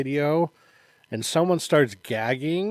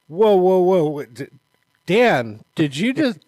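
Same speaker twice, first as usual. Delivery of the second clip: louder, halting mock disbelief; imitated startled reaction; 4 semitones higher, 5 dB louder than usual. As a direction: cut in loudly, then stumble over the question.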